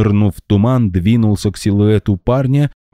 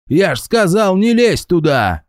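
high furious scolding; angry yell; 13 semitones higher than usual. A narrator's reading. Security guy yelling at Max, high angry. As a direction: bark at him for crossing the tape. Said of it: "орал ... в гневе".

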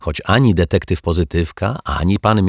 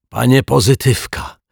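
raised, urgent call; agitated caller; 7 semitones higher, 4 dB louder than usual. A narrator's reading. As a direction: call out in a fluster, higher pitched. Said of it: "zaaferowany pan Mietek". (zaaferowane wołanie)

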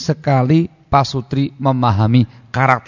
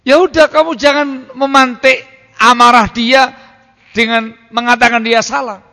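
high, loud example voice; acted advice; 13 semitones higher, 8 dB louder than usual. Preacher acting out advice to a husband, high and loud.

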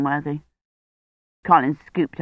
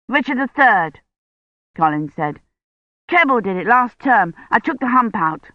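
put-on slightly higher boyish delivery; lightly voiced eager Colin; 4 semitones higher than usual. A narrator's reading. Colin tells Dunworthy about the school play costume, lightly voiced eager boy. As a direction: read the boy a little higher and keener.

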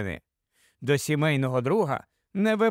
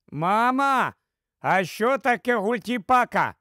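lightly voiced small boy, high-pitched; thin boyish falsetto; 5 semitones higher than usual. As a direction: call to mum in a thin child's voice. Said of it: "тонкий хлопчачий фальцет". (тонкий хлопчачий фальцет)

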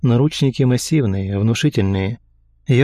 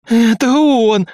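high, loud hoarse scream; hoarse yell; 12 semitones higher, 6 dB louder, much slower than usual. A narrator's reading. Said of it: "хрипло заорала девушка".

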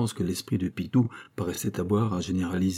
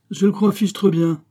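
high, loud burst of agreement; vexed, emphatic exclamation; emphatic retort; 9 semitones higher, 5 dB louder than usual. A narrator's reading. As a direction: exclaim it with force, pitched well up. Abbé's vexed exclamation to pupil.

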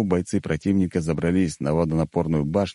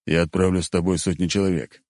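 ordinary delivery

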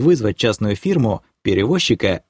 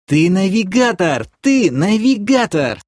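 high, slow, stunned outcry; shocked exclamation; 10 semitones higher, 4 dB louder, slower than usual. A narrator's reading. Girlfriend stuck repeating his secret, shocked and high. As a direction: cry it out in disbelief, stretching the words.

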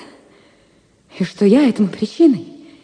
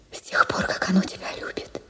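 barely voiced, falling to a whisper; hushed confession; 2 semitones lower, 11 dB quieter than usual. Vera's letter to him, whispered love confession. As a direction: breathe it out as a secret confession.

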